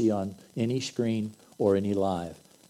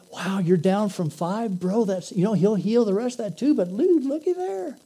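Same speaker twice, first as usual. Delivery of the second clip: excited dude; 9 semitones higher, 4 dB louder than usual. Acted. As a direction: cheer your buddy on like a stoked bro. Second buddy answering.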